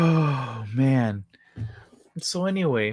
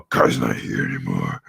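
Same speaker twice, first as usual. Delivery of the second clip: very low demonic croak; put-on deep demon voice; devilish voice. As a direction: drop right down into a possessed growl. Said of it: "the same way I did it for my brother".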